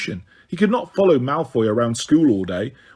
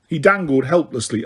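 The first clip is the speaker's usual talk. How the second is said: ordinary delivery, nothing marked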